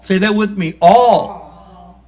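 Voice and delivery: slow, loud scripture reading; preaching. Acted. Preacher calling the verse out to the congregation, loud and slow.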